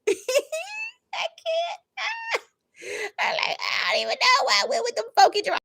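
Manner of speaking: high squealing laugh-cry; crying with laughter. Real, not acted. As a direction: laugh so hard the voice breaks upward. Laughing at own past choice.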